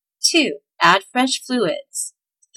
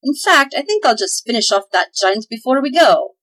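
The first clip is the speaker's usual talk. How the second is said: high, loud cocky boast; swaggering elf, louder and higher; boastful; 4 semitones higher, 5 dB louder than usual. Acted. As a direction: brag like a tiny hero. Elf boasts to kill giant.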